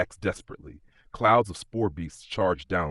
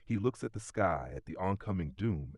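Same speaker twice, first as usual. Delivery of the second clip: ordinary delivery, nothing marked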